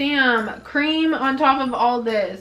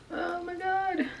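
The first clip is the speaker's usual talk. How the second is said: frustrated whimper; 8 semitones higher, 6 dB quieter, slower than usual. Real, not acted. Makeup creasing, frustrated.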